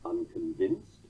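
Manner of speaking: "unconvinced" said slowly as un-con-VINCED, rising; slow, low "unconvinced", two light syllables then a beat; anapestic stress. Shown as a demonstration. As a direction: lean on the last syllable of "unconvinced". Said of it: "purely anapistic line is either a monometer".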